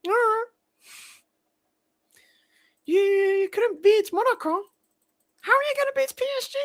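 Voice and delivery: squeaky high mutant troll voice. Pretend. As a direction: sound like a whiny little mutant. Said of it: "let me do a troll voice".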